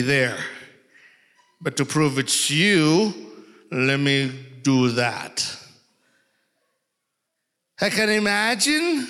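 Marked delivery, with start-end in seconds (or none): none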